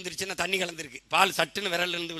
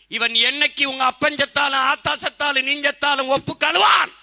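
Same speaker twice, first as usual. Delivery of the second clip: high, sustained angry shouting; furious tirade; 8 semitones higher, 9 dB louder than usual. Real, not acted. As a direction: harangue the crowd at full force. Tirade at crowd about rivals. (ஆவேசக் கத்தல்)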